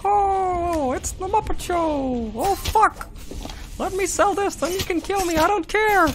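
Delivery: high puppet frog in a panic; Kermit the Frog. Acted. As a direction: wail it as a despairing puppet frog. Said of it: "Kermit the Frog's internal problems".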